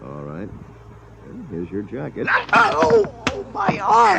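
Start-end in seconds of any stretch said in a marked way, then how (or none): 2.5-4.2 a sharp high yowl from a twisted arm; cry of pain. Acted. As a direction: yelp as the arm is wrenched. His arm is twisted in the struggle.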